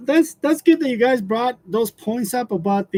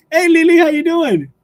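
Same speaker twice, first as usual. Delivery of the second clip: loud, high greeting; calling out; 8 semitones higher, 9 dB louder than usual. Real, not acted. Streamer greets a viewer joining the chat, calling out. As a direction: call out a hello over your story.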